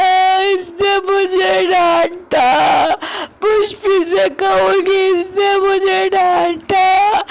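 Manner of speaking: high, slow blubbering tattle; tearful drunk tattling to Pushpi; drunken childish crying. Acted. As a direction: cry like a scolded child telling on her. Drunk man bawling like child.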